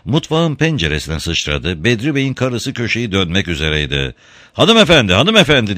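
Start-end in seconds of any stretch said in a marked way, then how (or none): 4.5-5.8 high, loud call across the street. A narrator's reading. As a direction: call her back urgently. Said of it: "diye seslendi".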